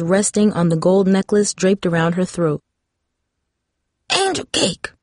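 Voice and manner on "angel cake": put-on high old woman calling out; frail grandma calling. A narrator's reading. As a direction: call from the bedroom, old and frail. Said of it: "Grandma yelled again".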